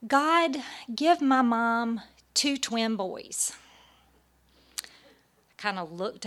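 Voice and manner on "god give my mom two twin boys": put-on high, loud little-girl prayer; Maggie. Three-year-old praying at the lunch table, high child voice. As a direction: pray like an earnest three-year-old, slowing on the words. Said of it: "I think she was three at the time".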